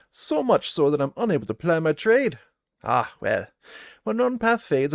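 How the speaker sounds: a gruff huntress greeting travellers; gruff trapper woman. Acted. Trapper Hannah greets the party, gruff and loud.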